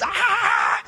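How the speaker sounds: high, loud playful shriek; childlike scream. Retold or imitated. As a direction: let out a kid's scream. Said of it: "screaming like children and just".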